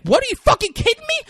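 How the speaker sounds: high-pitched, rapid incredulous outburst; mock shrieked disbelief; acted outrage. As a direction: act out being outraged, loud and fast. Retold outrage in a heated argument.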